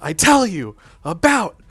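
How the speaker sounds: a giantess scolding her brother as she hits him, full shout; angry giantess yell. Acted. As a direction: yell it at him between blows. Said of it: "she says".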